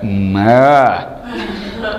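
low teasing hum then a short ah, half laughing; low playful grunt. Retold or imitated. Dad making a low silly noise at his baby son. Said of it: "I looked at him and I went".

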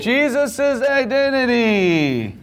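high, drawn-out singsong cheer; milk it with hammed-up excitement; exaggerated enthusiasm. Teacher echoing the class's answer with exaggerated enthusiasm.

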